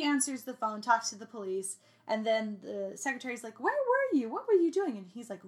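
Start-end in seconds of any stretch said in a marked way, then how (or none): 3.6-5.0 high, mock-posh British secretary; a bad British accent, pitched way up; hammed-up British accent; crappy British accent. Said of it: "I do it in the most crappy British accent".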